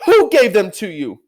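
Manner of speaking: high, loud, sharp demand; a queen demanding an answer, high and loud; demanding witch. Acted. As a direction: snap the question at him.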